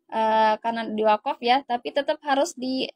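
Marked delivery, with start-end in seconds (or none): none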